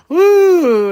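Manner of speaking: loud, high cheering whoop; big celebratory holler; excited woo. Real, not acted. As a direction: whoop like a fan in the stands. Cheering a gruesome match.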